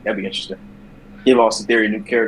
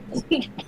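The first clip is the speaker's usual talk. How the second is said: high breathy laugh; 9 dB quieter than usual. Real, not acted. Laugh after joke.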